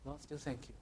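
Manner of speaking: soft prayer. Shown as a demonstration. Preacher models a quiet prayer of thanks to the Lord. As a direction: breathe it as a private prayer. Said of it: "we need to learn as Christians".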